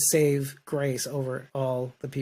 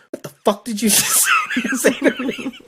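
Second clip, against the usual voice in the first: incredulous Morgan; 4 dB louder than usual. Retold or imitated. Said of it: "And Morgan goes".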